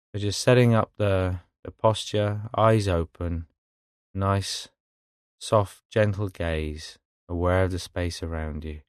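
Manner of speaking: calm, unhurried meditation guide with long pauses; guided meditation. Real, not acted. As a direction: ease them gently into stillness, phrase by phrase.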